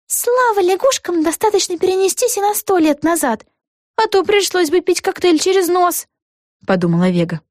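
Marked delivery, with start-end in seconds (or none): 0.1-6.1 put-on high girlish character voice; relieved heroine. A narrator's reading. Vega relieved not to drink through her nose, high girlish voice. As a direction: think aloud in a high little-girl voice.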